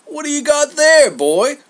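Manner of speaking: high, loud nosy demand; booming Zeus. Acted. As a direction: call out brashly to a servant. Zeus calls to the servant.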